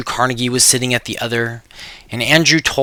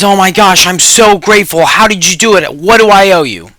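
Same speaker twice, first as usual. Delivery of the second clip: higher, louder, overjoyed thanks; excited gratitude; 7 semitones higher, 8 dB louder than usual. Acted. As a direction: gush with relief at the fixer. Fred thanks the technician.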